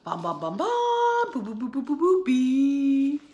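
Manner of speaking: loud sung trumpet notes, leaping high; loud trumpet sing-along; trumpet sounds. Shown as a demonstration. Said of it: "Say that with me".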